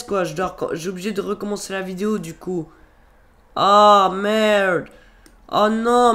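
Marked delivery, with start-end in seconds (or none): none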